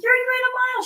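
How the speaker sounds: high, bright encouraging call; cheering her on. Retold or imitated. Coach calling to crying runner.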